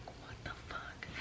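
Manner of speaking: whispered what the fuck; whispered disbelief at the stalker. Retold or imitated. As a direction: breathe it out in a whisper. Inner reaction to creepy stalker.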